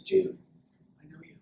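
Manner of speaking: quiet, lightly voiced creeper. Creeper greeting a stranger at the zoo, creeper voice. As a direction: sidle up and creep him out, very softly. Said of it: "Kinda like that creeper voice".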